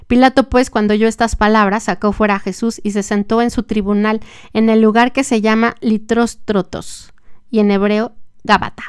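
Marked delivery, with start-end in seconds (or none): none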